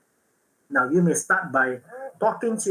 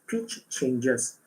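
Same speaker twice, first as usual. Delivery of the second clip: usual speaking voice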